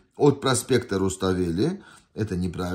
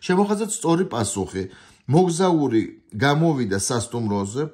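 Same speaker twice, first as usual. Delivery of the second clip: fast test-item reading; 4 semitones higher, 4 dB louder, much faster than usual. Shown as a demonstration. Teacher reading a Georgian test item fast. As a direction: rattle off the Georgian line quickly.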